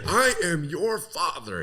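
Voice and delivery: high, slow, drawn-out mock declaration; melodramatic movie voice. Retelling what he keeps saying in the movie, high and drawn-out. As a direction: stretch it out high like a hammy film line. Mocking repeated movie line.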